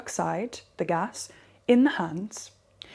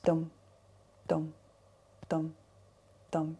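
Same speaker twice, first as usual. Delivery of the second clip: soft, evenly spaced "dum" beats; imitated tapping beat; 4 semitones lower, 6 dB quieter than usual. Shown as a demonstration. Teacher setting the pace for tapping, soft spoken beat.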